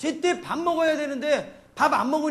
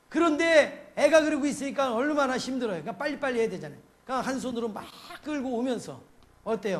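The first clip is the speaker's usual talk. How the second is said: usual speaking voice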